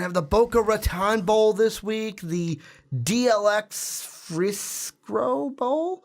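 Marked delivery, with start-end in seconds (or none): 3.0-6.0 slow, careful sounding-out of a name; unsure pronunciation. Real, not acted. Host reading out a bowl sponsor name, slow and unsure. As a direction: feel your way slowly through the name, rising in pitch. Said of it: "I think I said that right".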